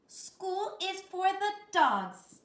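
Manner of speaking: high, slow, emphatic mother voice; emphatic Mama. A narrator's reading. Mother forbidding her kitten school, emphatic. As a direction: stretch it out, firmly laying down the law. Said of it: "stated Mama June Bun Jones emphatically".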